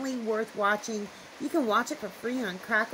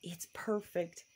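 whisper; 4 semitones lower, 8 dB quieter, slower than usual. Real, not acted. Softly pleased with the setup.

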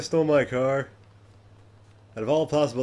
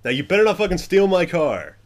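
loud warning; 6 semitones higher, 6 dB louder than usual. Real, not acted. Warning NPC about his car.

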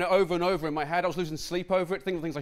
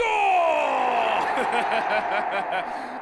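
shouted victory laugh; 7 semitones higher, 5 dB louder than usual. Acted.

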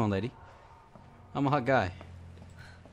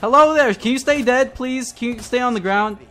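loud, high-pitched plea at a zombie; exasperated calling out; 9 semitones higher, 8 dB louder than usual. Real, not acted. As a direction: shout it at the thing that won't stay down. Calling out to zombie that won't die.

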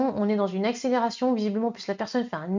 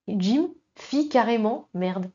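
"Jim fit carrément merde" read slowly, each word pointed for the double meaning; a teacher savouring an unintended pun, slowly; slower than usual. Shown as a demonstration. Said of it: "c'est ce que vous êtes en train de dire".

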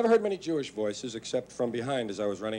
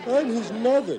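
mother's voice; 11 semitones higher, 7 dB louder, slower than usual. Retold or imitated. Voicing the mother.

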